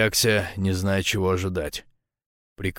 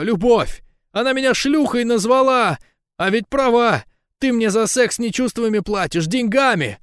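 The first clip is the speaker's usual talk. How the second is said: very high, impassioned woman's voice; loud, high, hurt pleading; desperate outburst; 13 semitones higher, 5 dB louder than usual. A narrator's reading. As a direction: cry it out as a wounded accusation. Lika's acted tearful quarrel.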